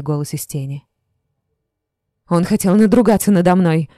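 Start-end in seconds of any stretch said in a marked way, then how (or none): 2.3-3.9 louder, sharp angry answer; girl answers the shadow sharply; sharp retort. A narrator's reading. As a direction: snap back at the voice. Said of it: "резко ответила я".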